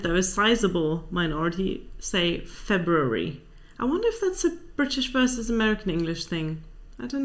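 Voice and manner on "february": "February" spoken slowly with both Rs; pronounced first R. Shown as a demonstration. Host reports the minority's pronunciation, first R pronounced. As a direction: slowly sound the R after the b in "February". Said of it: "forty-three point four percent".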